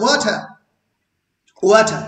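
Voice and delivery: Water with WA hit and ter dropped; জলের মতো: water শব্দের প্রথম অংশে জোর; stress on first syllable. Shown as a demonstration. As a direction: punch the first syllable of water, let the second fall. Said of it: "আর টারে stress পড়ছে না".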